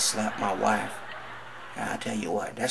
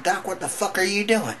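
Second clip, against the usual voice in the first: much higher, panicked outcry; shocked yelp; 11 semitones higher, 3 dB louder than usual. Acted. Patient crying out at the doctor, high and panicked.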